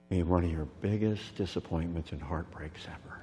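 hushed, breathless whisper. Real, not acted. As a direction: drop to a whisper to land the heartbreak. Sermon dropping to whisper.

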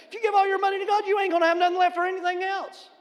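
high, loud scolding disbelief; loud exasperated dad. Retold or imitated. Pastor repeats his loud fatherly scolding to his son. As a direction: blast it like a fed-up father. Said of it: "now this is me".

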